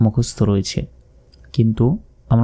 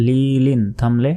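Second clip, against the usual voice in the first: ordinary delivery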